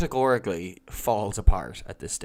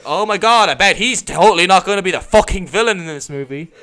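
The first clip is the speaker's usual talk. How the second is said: higher, louder mock amazement with a laugh; sarcastic audience; 5 semitones higher, 7 dB louder than usual. Mocking the predictable villain twist to friends, sarcastic and laughing. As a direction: fake gasp of obvious surprise. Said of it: "And you're just like".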